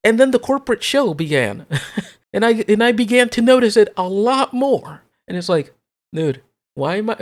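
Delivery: loud exasperated ranting; escalating comedy rant. Real, not acted. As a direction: shout it in mock outrage. Rant peaks, mock questions.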